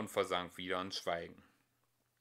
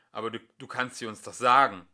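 louder, fast desperate plea; yelled; 10 dB louder, faster than usual. Acted. Lingual cries out to the computer, begging. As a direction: push it out urgently. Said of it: "schrie Lingual".

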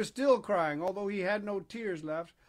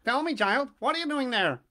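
loud, higher-pitched call; pigeon calling down; 6 semitones higher, 7 dB louder than usual. A narrator's reading. Pigeon calling to the puppet from high above, loud call. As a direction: call out loudly to someone far below. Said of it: "called down to him from a great height".

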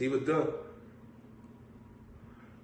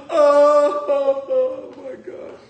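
laughing exclaim; 9 semitones higher, 9 dB louder, much slower than usual. Real, not acted. Reacting to own joke.

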